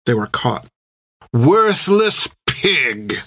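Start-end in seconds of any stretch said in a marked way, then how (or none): 1.3-3.2 high, very slow captain's insult; Creech spitting it. A narrator's reading. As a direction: spit it out slowly with contempt. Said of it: "spat Creech".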